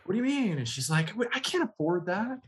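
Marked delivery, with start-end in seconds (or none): 0.1-0.6 high, indignant retort; mock-offended young self, pitched way up; outraged high question; indignant teen. Retelling argument with mom. 1.2-2.4 high, mild mom voice; lightly voiced mother, pitched up. Voicing mom.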